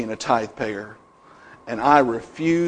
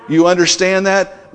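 ordinary talk

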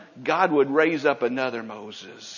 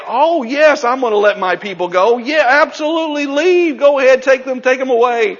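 mock eager Pharaoh; 9 semitones higher, 7 dB louder than usual. Pharaoh mocked as agreeable.

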